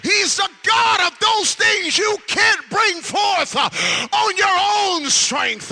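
loud, high preacher's cry building to a roar; preaching at full force. Acted.

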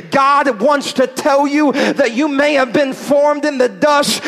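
preaching at full force. Acted. Preacher lifting up the doubting congregation, full-force preaching. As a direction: rise into a stirring declaration.

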